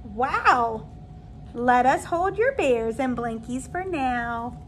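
lilting, sing-song storybook read; reading to children. Acted. Teacher reading a picture book to her new kindergarten class, lilting and gentle. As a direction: read it aloud like a bedtime story for little kids.

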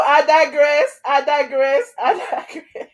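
playful laughing. Real, not acted. Repeated playful sign-off.

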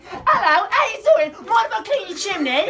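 high, loud chimney-sweep English; mock English accent. Giving the mom the English voice she wanted, hammed-up accent. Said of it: "'Cause what she wanted to happen was she wanted to be like".

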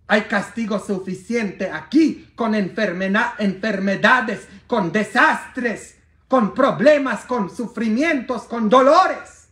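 high, loud, slow preaching build; preaching at full force. Acted. Preacher to congregation listing suffering, loud and rising. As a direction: hammer each item out to the congregation.

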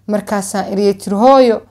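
usual speaking voice

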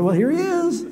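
very high, loud exclaim; elders surprised. Acted. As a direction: act startled to see him. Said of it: "they said".

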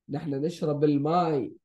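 fast, fluent Arabic read; quick Arabic model. Shown as a demonstration. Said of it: "let's read".

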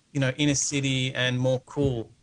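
normal talking voice

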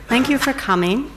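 usual speaking voice

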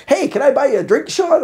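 loud, high call, rising on the name; mock hearty call. Passenger at the free soda fountain offering a drink, mock hearty call. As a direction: imitate an over-eager fan hailing him. Imitating fan calling out offer.

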